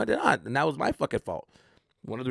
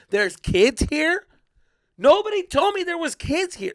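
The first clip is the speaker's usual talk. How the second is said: rising, louder disbelief; high and loud, can't believe it; shocked realization; 12 semitones higher, 6 dB louder than usual. Retold or imitated. Comic at church gig, spotting kids, shocked disbelief.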